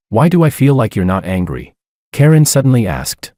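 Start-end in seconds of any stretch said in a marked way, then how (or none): none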